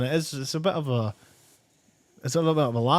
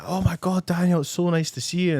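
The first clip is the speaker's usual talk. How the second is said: delighted greeting; 6 semitones higher than usual. Retold or imitated. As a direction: act out her thrilled hello. Voices schoolmate delighted to see him.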